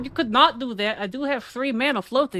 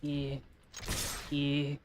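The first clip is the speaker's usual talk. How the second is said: ordinary talk, nothing marked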